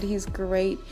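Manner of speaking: normal talking voice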